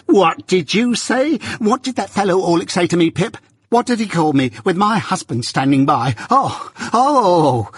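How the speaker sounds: high, rising hysterical outrage; beginning to scream. Acted. Sister appealing with her husband standing by, screaming. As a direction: work up from outraged cries into shrieks. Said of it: "beginning to scream".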